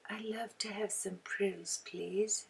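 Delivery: put-on hushed little English-accent request. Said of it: "Reminds me of somebody with an English accent".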